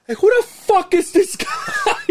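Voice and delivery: put-on high, loud, slow drunken yell, breaking into laughs; hammed-up tipsy friend shouting at a stranger. Tipsy Waldo yelling at a stranger.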